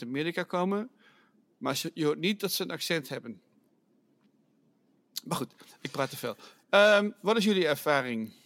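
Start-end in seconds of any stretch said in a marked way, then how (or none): 5.2-6.4 soft whisper, almost to herself; whispered aside. Real, not acted. Host cutting her own ramble short, whispered. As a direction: drop to a whisper and scold yourself.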